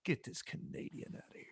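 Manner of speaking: unvoiced whisper; imagined whispered thought. Imagined locals silently wanting the Canadian gone, whispered. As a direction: mutter it under the breath. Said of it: "they were like".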